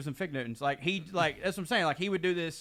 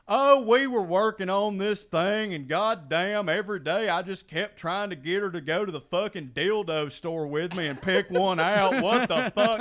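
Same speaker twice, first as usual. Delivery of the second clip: imitating Wade; 4 semitones higher, 7 dB louder than usual. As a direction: brag it like a guy who thinks it's harmless. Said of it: "He goes".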